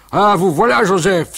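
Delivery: loud high joyful greeting; joyful cry. Acted. Uncle Jules greeting the father, loud joyful cry. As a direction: call out with surprising joy. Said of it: "s'écria-t-il avec une joie surprenante".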